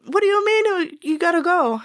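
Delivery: mock the boss's weird broken accent; GM's broken accent. Cook imitating the GM for listeners, high broken accent. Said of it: "she's got this weird broken accent".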